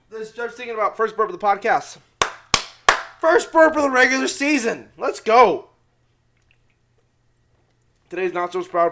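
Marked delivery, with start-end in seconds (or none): 3.2-5.7 loud, raised celebratory call; mock-triumphant cheer. Real, not acted. Host celebrating first burp of the season, loud cheer. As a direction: announce it loudly like a big milestone.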